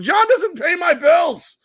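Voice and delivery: high, loud, slow sarcastic put-down; slow and loud, putting him in his place; mock indignant retort. Homeowner snaps at lawn guy.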